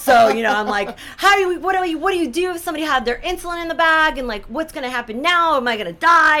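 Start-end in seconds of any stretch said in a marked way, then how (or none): 1.2-6.4 higher-pitched mock panicked demand; making a scene. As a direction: work yourself up into a big dramatic scene. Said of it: "I'm making this big scene".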